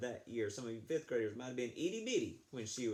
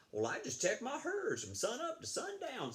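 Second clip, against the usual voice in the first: higher, easygoing rancher voice; high folksy Josh; 12 semitones higher than usual. Acted. Josh answers the boastful Damien, high and easygoing. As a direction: answer simply, lifted up high. Josh's humble reply to boasting runner.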